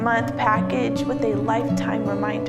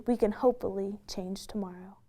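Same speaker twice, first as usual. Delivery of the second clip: breathy whisper over music; soft whispered close; 11 dB quieter than usual. Acted. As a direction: let it fade to a whisper. Closing line of pageant intro.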